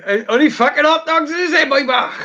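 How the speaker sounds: high, laughing Welsh film quote; Welsh accent. Retold or imitated. Friend repeating a Twin Town quote in a laughing Welsh accent. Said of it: "you reminded me of someone off Twin Town".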